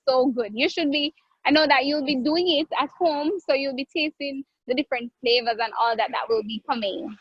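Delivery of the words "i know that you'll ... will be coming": ordinary talk, nothing marked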